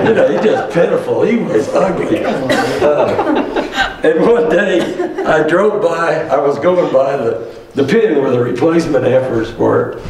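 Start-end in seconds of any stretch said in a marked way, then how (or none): 0.1-4.8 laughing. Real, not acted. Laughing at the ugly steer.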